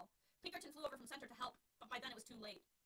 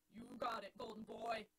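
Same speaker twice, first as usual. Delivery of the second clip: Jack's voice; 4 dB louder, slower than usual. Acted. Jack answers Alex.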